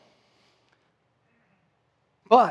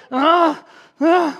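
mock baby cries; 11 semitones higher, 8 dB louder than usual. Baby crying while bottle is filled.

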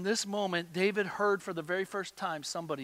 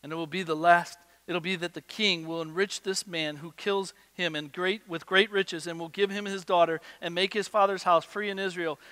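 ordinary talk

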